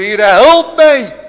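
high, loud, slow drawn-out cry; sinking man's cry. Acted. Peter sinking in the waves, slow desperate cry. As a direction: Peter crying as he sinks. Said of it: "Toen heeft hij ook geroepen".

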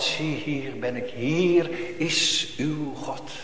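soft and drawn out, barely above a breath; hushed slow words. Pretend. Preacher voicing Christ to the congregation, hushed and slow. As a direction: let the words hang softly, slowly.

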